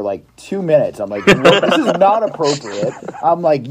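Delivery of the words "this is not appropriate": slow, high mock scolding; retold berating. Counselor tells off a kid at camp, retold berating. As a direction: spell it out like an exasperated counselor. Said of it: "berated him for".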